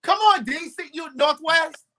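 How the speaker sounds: high, excited hometown cheer; hype shout-out. Real, not acted. Host hypes the guest's DC hometown, high and excited.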